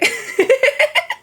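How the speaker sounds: giggling at the joke; high giggle. Real, not acted. Laughing at the pun.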